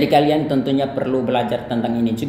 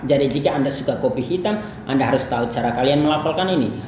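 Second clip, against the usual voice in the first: ordinary talk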